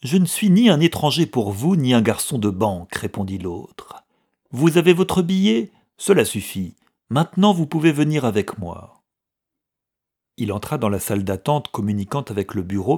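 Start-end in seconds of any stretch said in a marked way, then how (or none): 0.0-9.0 raised, louder voice, insistent; firm, emphatic command to follow; assertive retort. A narrator's reading. Stranger brushing off the clerk's objection, assertive.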